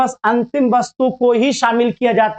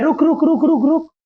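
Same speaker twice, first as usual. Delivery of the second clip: higher-pitched repeated stop calls; mock-urgent string of stops; urgent halting; 5 semitones higher than usual. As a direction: call out to halt someone walking off. Acts out halting the school buyer.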